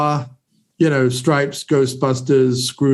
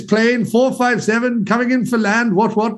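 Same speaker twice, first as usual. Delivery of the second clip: put-on hammy posh British accent, pitched higher; Colm Meaney's British accent; 6 semitones higher than usual. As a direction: put on a comic British air-traffic accent. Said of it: "he's trying to do, like, the British accent".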